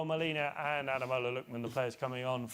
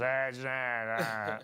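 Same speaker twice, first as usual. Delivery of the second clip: mock West Country farmer; much slower than usual. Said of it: "Where he goes".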